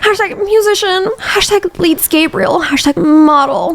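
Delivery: high, slow mocking hashtags. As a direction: sneer out each hashtag in a high voice.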